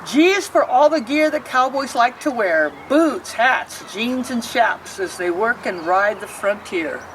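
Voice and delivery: put-on lilting rhyme read aloud to kids; reading to children. A narrator's reading. Librarian reading a picture book to children, lilting. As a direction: read each verse like storytime.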